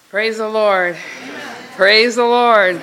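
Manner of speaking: loud, drawn-out praise call. Real, not acted. Opening her tribute to Chris in church, loud praise. New speaker opens with praise to congregation.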